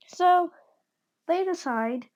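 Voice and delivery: ordinary delivery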